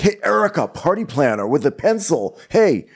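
a lightly high, hesitant asking voice; imitating Austin. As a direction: mimic Austin nervously asking the planner. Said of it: "so Austin's like".

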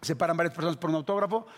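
usual speaking voice

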